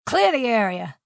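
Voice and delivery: loud guard's order; forceful, carrying bellow; commanding shout. A narrator's reading. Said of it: "a guard commanded".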